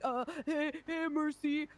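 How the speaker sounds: mocking nervous voice. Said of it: "Don't overthink it or stutter or be like".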